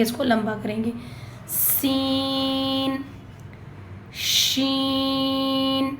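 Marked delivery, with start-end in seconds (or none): 1.5-5.9 سین then شین, each drawn out slowly; long drawn letters. Shown as a demonstration. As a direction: stretch both letters long, hissing and spreading. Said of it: "اس کو بھی کھینچنا ہے". (سین اور شین لمبا کھینچ کر)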